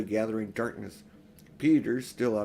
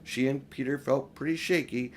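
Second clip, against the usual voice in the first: ordinary delivery, nothing marked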